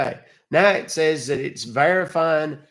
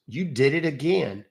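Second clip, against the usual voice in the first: ordinary talk, nothing marked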